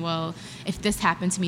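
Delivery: usual speaking voice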